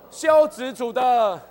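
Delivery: preacher drives the point home; emphatic shout. Real, not acted. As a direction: proclaim it like a rallying cry. Preacher stressing key phrase.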